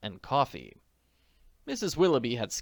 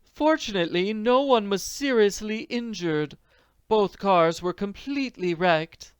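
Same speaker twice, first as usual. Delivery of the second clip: put-on elderly manager; 9 semitones higher, 5 dB louder than usual. Manager voiced.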